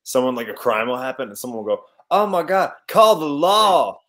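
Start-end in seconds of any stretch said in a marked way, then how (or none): 2.1-4.0 high, loud East Texas holler; mock-alarmed East Texas drawl; East Texas accent. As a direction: cry it out like an alarmed East Texan, drawn out. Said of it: "East Texas is the only place where you'll ever hear".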